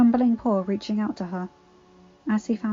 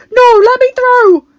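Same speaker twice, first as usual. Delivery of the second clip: high, loud, slow desperate shout; 17 dB louder than usual. A narrator's reading. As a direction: shout it, pounding the wall. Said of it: "he shouted".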